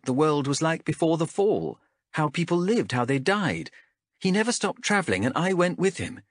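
lightly voiced guarded young ranger; a hint of a defensive wanderer; young woman character voice. Chev voiced by narrator.

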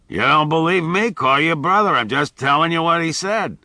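put-on old Max. A narrator's reading.

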